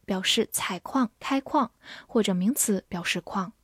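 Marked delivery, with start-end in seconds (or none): none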